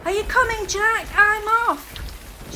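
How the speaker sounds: high, loud shout over the storm; Matt shouting. A narrator's reading. Matt shouting to Jack over the storm, loud. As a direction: call out to be heard through the rain. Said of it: "shouted Matt".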